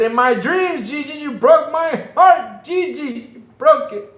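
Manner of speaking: high, loud fake wail; hammed-up pleading whine; mock heartbroken. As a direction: pretend heartbreak cry. Mock-wailing heartbroken plea.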